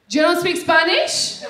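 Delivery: put-on slow, accented disbelief, sharply rising question; incredulous greeter. Greeter incredulous at her reply.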